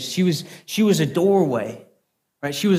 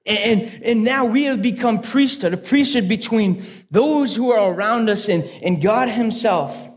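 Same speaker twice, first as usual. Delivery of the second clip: higher, driving sermon delivery with punched key words; impassioned preaching; 5 semitones higher, 4 dB louder than usual. Real, not acted. Preacher telling congregation they have become priesthood, impassioned preaching. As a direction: preach it with building fervour. Preaches the lesson of his story.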